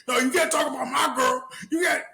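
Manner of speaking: squeaky imitation of him getting worked up; imitating a guy defending his girlfriend; defensive boyfriend. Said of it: "he looks like the type to be like".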